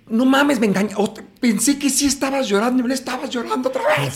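high, loud, amazed; an actress fooled again by the scene; amazed exclamation. Retold or imitated. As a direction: exclaim in delighted disbelief. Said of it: "Y Fernanda me decía".

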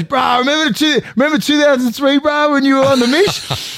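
put-on high, loud kid voice; cheeky kid. Young Jamal teasing him about the Mish, high loud kid voice. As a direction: grin it out like a cheeky young boy. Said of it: "He goes".

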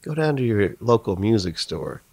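slow, high radio jingle host; announcer voice. Pretend. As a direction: introduce the segment like a DJ. Mock radio segment intro.

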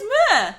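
"moor" in Geordie, like "muh-uh"; "moor" with an added "uh" vowel; Geordie pronunciation. Shown as a demonstration. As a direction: say "moor" high and loud with a Geordie "uh" ending. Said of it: "Why don't you say it properly".

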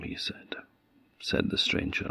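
usual speaking voice

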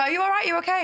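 high, worried asking; concerned check-in. Retold or imitated. Asking her stimming housemate if she's okay, concerned high voice.

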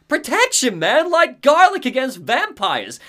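high, excited Barry impression; mock-heroic Barry bragging. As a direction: ham up the cocky survivalist. Barry voiced in game recap.

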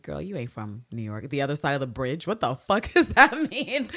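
mock New Yorker scoff.

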